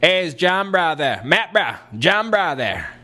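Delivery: imitated Texan pal on the phone; Gary. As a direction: call out for a buddy in bro speak. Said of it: "And he called up and he".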